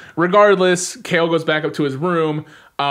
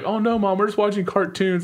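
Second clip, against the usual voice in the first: lightly voiced kid, higher pitched; mock innocent kid; 6 semitones higher than usual. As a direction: pitch it up, playing innocent to mom. Voicing kids covering up to mom.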